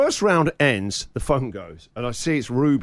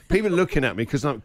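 normal talking voice

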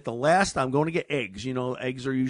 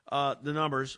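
usual speaking voice

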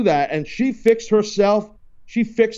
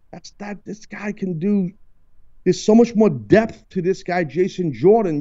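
normal talking voice

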